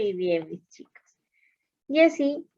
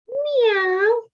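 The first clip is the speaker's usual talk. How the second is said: cat meow; 12 semitones higher, 3 dB louder than usual. Shown as a demonstration. Teacher voicing cat sound.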